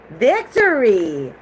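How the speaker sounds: loud, slow cry of victory; excited exclamation. Real, not acted. Tarot reader announcing a card to the viewer, drawn-out excited cry. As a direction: draw out the word in delight.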